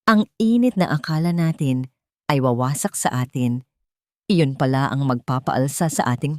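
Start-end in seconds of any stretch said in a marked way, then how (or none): none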